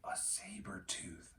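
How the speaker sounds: breathless frightened whisper. A narrator's reading. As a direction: whisper it so the tiger can't hear. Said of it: "said Jack".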